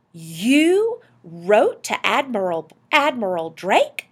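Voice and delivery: loud, slow, incredulous question; astonished. Acted. Wife learning husband wrote to an explorer, astonished. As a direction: stretch it out in disbelief.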